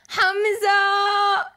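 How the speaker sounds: loud call to a guest. Real, not acted. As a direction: call out his name across the room. Greeting a guest, calling out.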